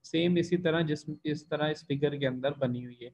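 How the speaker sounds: ordinary talk, nothing marked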